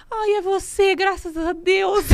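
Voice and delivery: bem mais agudo e arrastado, alívio de brincadeira; alívio imitado. Retold or imitated. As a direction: imita o alívio exagerado ao ver a Pri. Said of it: "nesse dia eu falei". (alívio exagerado imitado, voz bem aguda)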